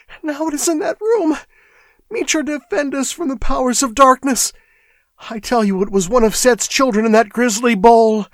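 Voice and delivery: high, shrill, panicked voice cracking with fear; frantic clerk babbling that the place is cursed; hysterical excitement. A narrator's reading. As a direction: babble it out in hysterical terror, whispering the prayer. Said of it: "shrilled and cracked with hysterical excitement".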